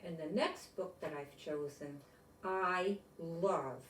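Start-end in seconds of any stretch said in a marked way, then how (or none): none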